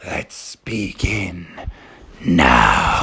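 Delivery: very low, slow "let's begin"; deep mock announcer voice; deep slow intro. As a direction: drop the voice way down to start.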